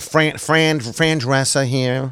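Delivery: slow, drawn-out nanny impression; Fran Drescher voice. Host introducing himself as the nanny, slow New York impression. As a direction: introduce yourself in a put-on New York whine. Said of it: "it's a great voice".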